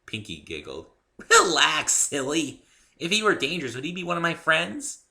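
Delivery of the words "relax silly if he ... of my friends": high, loud bouncy pony voice; bubbly pony. A narrator's reading. Pinkie explaining Spike to the newcomer, high and bubbly. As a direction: chirp it like a cheerful pony.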